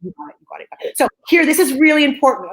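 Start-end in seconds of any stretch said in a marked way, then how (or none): 0.0-1.0 meek concession. Retold or imitated. Friends backing down, retold.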